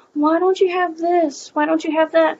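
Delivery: demanding customer. Retold or imitated. Voicing demanding store customers.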